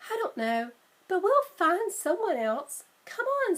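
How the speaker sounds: rising to a high, keen call; eager Annie. Acted. Sister urging Jack across the park, eager and high. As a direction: pull her brother along.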